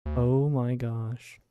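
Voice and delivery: normal talking voice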